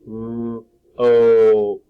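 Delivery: "R, O." with a loud long "O"; teacher sounding out rope. Shown as a demonstration. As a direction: stretch out the O in rope. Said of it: "It is the long O sound".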